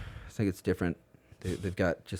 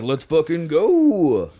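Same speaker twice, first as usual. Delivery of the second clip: hyped shout; 7 semitones higher, 8 dB louder, much slower than usual. Retold or imitated. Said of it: "And he was like".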